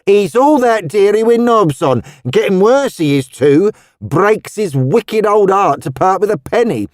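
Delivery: put-on high, lively Cockney old woman; Cockney charwoman. A narrator's reading. Missus Tubbs startled by Roseanne's outfit, high lively Cockney.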